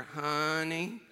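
put-on sweet old lady's Alabama drawl on the phone; deep Alabama accent. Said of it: "she had this deep Alabama accent".

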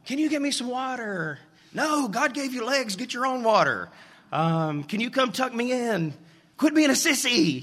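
put-on high-pitched kids and parent calling across the house; mock family yelling. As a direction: play the kids and the gruff parent shouting between rooms. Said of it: "we used to scream across the house at night".

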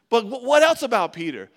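ordinary delivery

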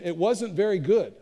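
ordinary talk, nothing marked

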